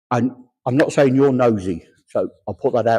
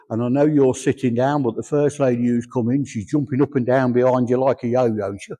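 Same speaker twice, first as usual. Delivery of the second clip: ordinary talk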